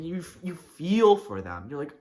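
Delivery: normal talking voice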